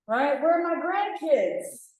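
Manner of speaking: high, slow, drawn-out demand; hammed-up prying parent; nagging parent. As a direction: needle them for grandkids. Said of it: "digging under our skin".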